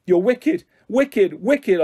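high, loud outraged shout. A narrator's reading. Bullied girl snaps at her tormentor, furious shout. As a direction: shout the accusation, hammering the repeated word. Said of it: "I shouted".

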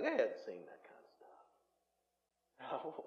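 slow, whispered aside; hushed aside. Acted. Preacher telling the congregation about the synagogue, whispered. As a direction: drop to a whisper, slow and secretive.

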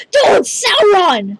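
high, slow, thrilled cry of the name; shouting the villain's name in awe; excited shout. Real, not acted. Kid spotting Sauron in game, thrilled high cry.

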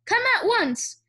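high, urgent order; shouted summons. A narrator's reading. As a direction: call him over in alarm. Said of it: "she shouted".

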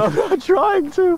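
high, slow goofy voice; silly high voice. Acted. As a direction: squeak it out slowly as a joke. Amused reply to friend.

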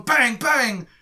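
imitation gun bangs; mimed gunshots. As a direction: call out pretend shots. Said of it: "they start going".

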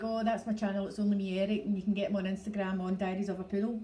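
quick, run-together Scottish chatter; fast everyday Scottish. Shown as a demonstration. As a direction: rattle it off as if to a friend. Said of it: "going to talk to a friend, I would say something like".

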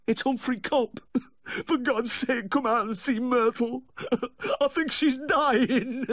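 very high, wailing plea broken by sobs; pleading between sobs. A narrator's reading. Dog owner begging the vet to come out, sobbing. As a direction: beg in a high crying voice, sobbing between phrases. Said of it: "pleading between sobs".

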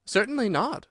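high, emphatic denial; indignant. A narrator's reading. Alice answering about washing, indignant. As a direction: reject it with offended dignity. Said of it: "said Alice indignantly".

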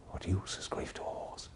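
soft wondering whisper. Acted. Psychiatrist asking himself the question in a whisper. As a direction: breathe the question, no voice. Private musing monologue.

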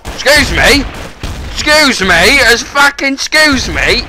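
high, loud outraged calls; indignant shouting. Real, not acted.

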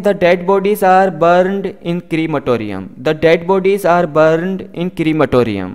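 very slow, careful English for learners; slow model sentence. Shown as a demonstration. Teacher reading the example slowly to students. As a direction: spell each word out slowly for the class. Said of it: "teacher model sentence".

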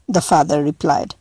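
ordinary delivery, nothing marked

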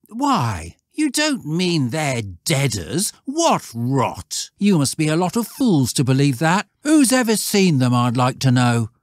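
high, loud scornful schoolboy; jeering lad, rising to a loud challenge; scornful, bitter. Acted. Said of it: "Stanley was scornful and bitter".

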